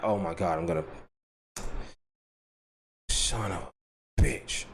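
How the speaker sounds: normal talking voice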